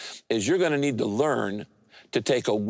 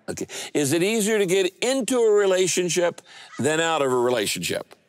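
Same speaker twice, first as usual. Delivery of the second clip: usual speaking voice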